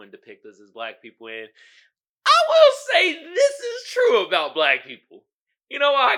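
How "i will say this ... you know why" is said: high, loud laughing through the words. Real, not acted. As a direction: crack up as you say it. Laughs while joking about running.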